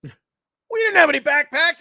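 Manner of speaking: high, slow, loud mock protest; indignant bosses. Bosses puzzled about the backpacks, indignant. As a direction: drag out an imitated objection up high. Voices bosses challenging him.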